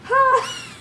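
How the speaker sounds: high rising giggle; a quick amused giggle; laugh. Real, not acted. Laughing at the story.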